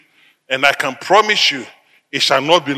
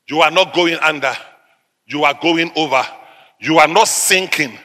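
usual speaking voice

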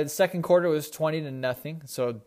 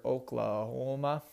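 put-on a shaky try at an Oklahoma accent, drifting toward Canadian; botched Oklahoma accent that slips Canadian; attempted Oklahoma accent; 3 semitones lower, 7 dB quieter, slower than usual. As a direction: put on a mild Oklahoma drawl, half-successful. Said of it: "I gotta work on my Oklahoma accent".

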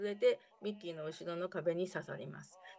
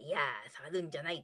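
put-on lower, odd old-man phrasing; funny way of saying it; 6 semitones lower than usual. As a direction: say it low like a fussy old man. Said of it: "この言い方が面白い". (面白い言い方)

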